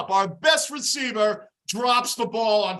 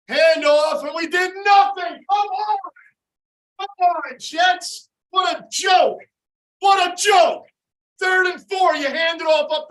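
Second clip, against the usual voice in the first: higher, louder furious yell; angry fan shouting; 6 semitones higher, 5 dB louder than usual. Real, not acted. Fan raging at the Jets' failed run, furious shout. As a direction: yell your disgust at the screen. Fan erupts at bad play.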